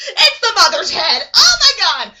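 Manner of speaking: very loud, hammed-up horror; acted shock at full volume; horrified shriek. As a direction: cry out at a gruesome find.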